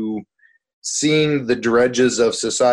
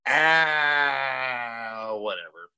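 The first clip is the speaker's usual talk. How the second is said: lazy zombie moan; 6 dB quieter, much slower than usual. Acted. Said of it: "That's your zombie moan?".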